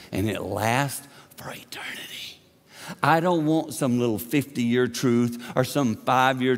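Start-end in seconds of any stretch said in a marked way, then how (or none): 0.1-2.1 sudden whisper. Real, not acted. Preacher drops voice for emphasis.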